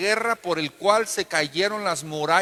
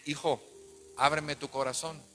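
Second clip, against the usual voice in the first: softer, lower fatherly coaxing; mock-tender coaxing; 3 semitones lower, 8 dB quieter than usual. Joshua interrogating Acán, softly mock-tender. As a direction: coax him gently like a father. Josué coaxes Acán, joking.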